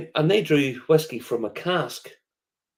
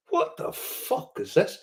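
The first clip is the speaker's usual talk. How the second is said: slow, breath-only astonishment; hushed mock amazement; imitated awed disbelief; 8 semitones higher, 4 dB quieter, slower than usual. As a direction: whisper it like a stunned visitor, stretching the words. Repeats visitors' awed reaction.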